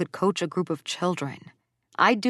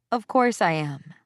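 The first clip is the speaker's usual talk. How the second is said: ordinary delivery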